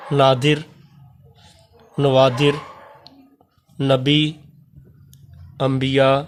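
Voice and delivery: slow, clear reading of each Urdu word with pauses; careful word drill. Shown as a demonstration. Teacher reading plural forms to class, careful drill. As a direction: read each word crisply for students to copy.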